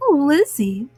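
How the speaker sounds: plain reading voice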